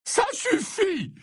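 very high, drawn-out cry of distress; anguished high-pitched protest; sudden cry. A narrator's reading. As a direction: cry out in a high, strained old man's voice without raising the volume. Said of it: "s'écria soudain Slughorn".

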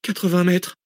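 high, drained announcement of danger; toneless with fear. A narrator's reading. Passenger counting down pursuers' distance, voice drained by fear. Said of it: "annonça Cécile d'une voix blanche".

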